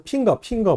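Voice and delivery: 핑거 with a P sound in place of F; Korean-style "pinger" for finger. Retold or imitated. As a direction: say 핑거 as Koreans do, starting on P. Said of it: "F를 발음 안 하고 P 발음해서".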